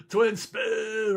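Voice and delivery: loud, high game-show call; announcer call. Acted. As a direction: shout it like a game-show host. Calling out a listener's spin.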